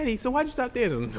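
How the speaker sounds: put-on high, sweet little girl asking. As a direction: play the small daughter asking. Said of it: "she gonna grow up like".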